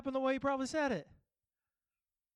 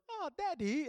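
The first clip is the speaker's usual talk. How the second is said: put-on higher-pitched child's "Daddy" in an Australian accent; imitated Australian-accented kid; 6 semitones higher than usual. Dad imitating his son greeting him, Australian accent. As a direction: mimic a small kid copying Australian cartoon speech. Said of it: "my kid goes".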